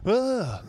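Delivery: high startled ah; anime gasp. Retold or imitated. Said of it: "understands the extent what going".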